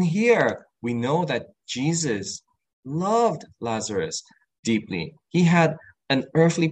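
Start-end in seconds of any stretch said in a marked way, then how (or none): none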